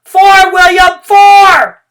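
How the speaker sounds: the whole class yells the number; shouted cheer. A narrator's reading. Room nine cheering William on, shouting. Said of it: "we shouted".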